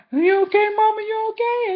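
high, anxious calling out; a scared kid checking on her; girl calling anxiously to her godmother, high pitched; worried child. Retold or imitated. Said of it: "And I'm like".

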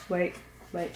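ordinary delivery

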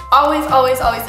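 emphatic repetition. Real, not acted. Urging viewers to ice face.

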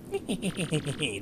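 leises, meckerndes Schurkengelächter; Schurkenlachen. Acted. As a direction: kichere gehässig wie ein Spielschurke. Villain laugh in game dialogue. (hämisches Kichern des Bösewichts)

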